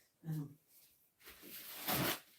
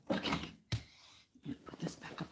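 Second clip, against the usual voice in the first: whisper; 17 dB quieter than usual. Real, not acted. Whispered aside.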